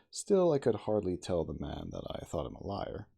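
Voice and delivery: usual speaking voice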